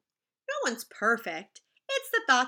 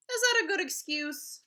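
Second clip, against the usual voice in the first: usual speaking voice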